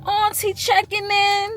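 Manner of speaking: high, slow sing-song auntie greeting; sweet auntie checking in, stretched out; imitating auntie's voice. Aunt greeting her niece late at night, high sing-song. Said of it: "That's how she talk".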